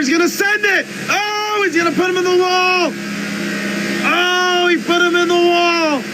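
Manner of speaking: excited shout. Real, not acted. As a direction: yell it out the moment he hits the wall. Wreck on the final lap.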